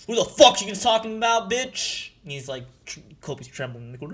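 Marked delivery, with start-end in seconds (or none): 0.1-2.1 loud, higher angry confrontation; barreling-in villain. Acted. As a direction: storm in yelling a challenge. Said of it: "comes barreling through".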